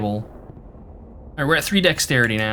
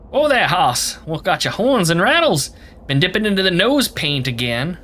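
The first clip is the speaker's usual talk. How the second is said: put-on higher old-west prospector twang; cowboy drawl; 3 semitones higher than usual. Voicing the cowboy Gabby greeting the dinosaur, mild old-west twang. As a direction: play a folksy cowboy sidekick. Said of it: "Gabby doesn't talk like that".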